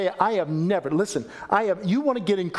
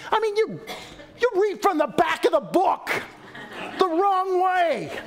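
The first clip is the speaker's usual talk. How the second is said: high, laughing exasperation; pitched-up comic protest; exasperated outburst; 12 semitones higher than usual. Real, not acted. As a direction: throw up your hands at backwards reading. Mock exasperation about Hebrew.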